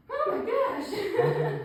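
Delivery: high, drawn-out amused gasp; laughing exclaim. Retold or imitated. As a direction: act out a delighted shock. Acting out being stuck, laughing.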